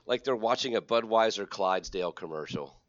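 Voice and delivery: big host voice, lifted on key words; show-opening announcer. Acted. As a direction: hype up the episode like a host. Hosts the show's opening.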